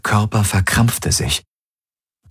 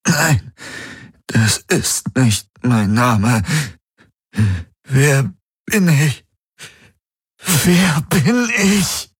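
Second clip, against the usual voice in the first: anguished man; 9 semitones higher, much slower than usual. Acted. Garlin in anguish.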